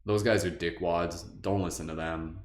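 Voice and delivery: soft, low and comforting; gentle reassurance. Retold or imitated. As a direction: soothe a hurt friend quietly. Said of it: "just be like".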